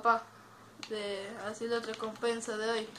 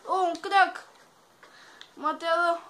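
excited; 7 semitones higher, 8 dB louder than usual. Real, not acted. Excited kill in game.

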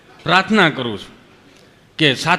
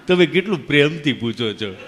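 laughing through the words; 4 semitones lower than usual. Retold or imitated. Gabho laughing at how sweetly the nurse asks. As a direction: chuckle while teasing her.

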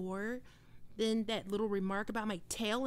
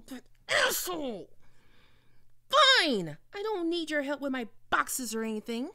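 loud huffy rant to herself; angry outburst; 5 semitones higher, 7 dB louder than usual. Acted. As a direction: vent the anger out loud. Fuming after roommate leaves.

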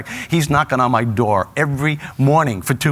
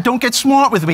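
raised, higher stern warning; scolding dad; 10 semitones higher, 4 dB louder than usual. Retold or imitated. Dad scolding his cheeky son, raised and sharp. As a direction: snap at the smart-mouthed kid.

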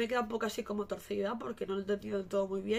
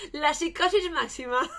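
high, loud, through real laughter; laughing; 9 semitones higher, 4 dB louder than usual. Real, not acted. Vlogger announcing the next segment, laughing hard. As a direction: crack up while announcing it. Laughs round her own big reveal.

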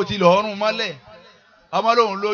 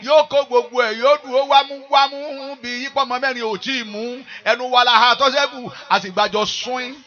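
higher, sing-song sermon delivery; preaching; 5 semitones higher, 3 dB louder, slower than usual. Real, not acted. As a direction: preach it in raised, intoned phrases. Preacher intones the translation.

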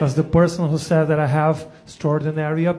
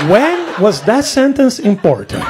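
shouting, laughing, louder all through; loud comic rant; 3 semitones higher, 9 dB louder than usual. Real, not acted. Comedian yelling at Americans about Spanish class, loud and laughing. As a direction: yell the punchline at the crowd.

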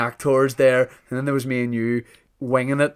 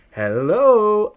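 laid-back, higher-pitched hello; very relaxed; 7 semitones higher, 4 dB louder than usual. Retold or imitated. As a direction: imitate a totally unbothered greeting. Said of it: "as relaxed as anyone could be".

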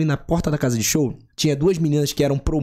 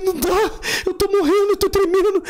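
mock panicked whine. Panicked retelling, pitched way up.

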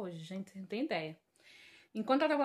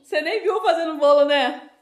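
loud, high, excited teasing; gleeful teasing; 6 semitones higher, 15 dB louder than usual. Real, not acted. Mother teasing daughter who missed the baking, gleeful. Mother gloats over the surprise.

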